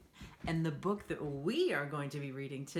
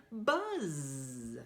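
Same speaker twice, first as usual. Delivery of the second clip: buzzing; 4 semitones lower than usual. Acted. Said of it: "He can sound like a bee".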